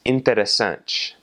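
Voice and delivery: "interessante" ending in "ch" for te; Brazilian ch sound. Shown as a demonstration. Teacher showing the word for interesting, Brazilian pronunciation. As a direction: say "interessante" slowly as in-teh-reh-SANCH. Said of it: "a similar rule applies".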